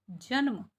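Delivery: जन्म as "janm", no vowel between न and म; half N in जन्म. Shown as a demonstration. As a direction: close the न directly onto म in जन्म. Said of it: "आधे ने की साउंड आ रही है". (जन्म में आधा न जोड़कर बोलें)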